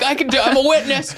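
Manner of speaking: high, laughing mock eagerness; volunteer in a high, giddy voice; eager witness. Plays himself as over-eager witness.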